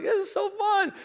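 mock-enthusiastic high squeal; imitated cheery companion. Wife at the cold tree lighting, mock-cheery imitation. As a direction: put on a very high, perky voice. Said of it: "Alyssa's like".

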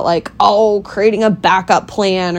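usual speaking voice

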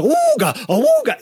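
very high cartoon horn hoot; imitated klaxon yelp of alarm; cartoon awooga. Shown as a demonstration. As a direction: whoop it like a startled cartoon wolf. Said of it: "I'm just stealing sound effects".

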